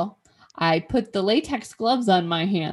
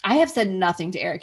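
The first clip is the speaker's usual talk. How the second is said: ordinary talk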